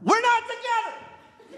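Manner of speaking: very high, drawn-out mimicking screech; mock drunken scream. Imitating the front-row couple, drunken scream. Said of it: "drunkenly screaming".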